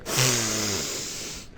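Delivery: open, satisfied exhale; energized contented breath; refreshing deep breath. Shown as a demonstration. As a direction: breathe in a fresh new day. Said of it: "or is breathing like".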